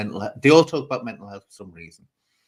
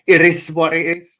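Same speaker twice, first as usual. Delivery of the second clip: catchphrase punched higher and louder; loud mock catchphrase; 5 semitones higher, 9 dB louder than usual. Mocking the streamer's famous line, louder and higher. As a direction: belt out "It is what it is" as a parody. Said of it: "I'm nearly saying".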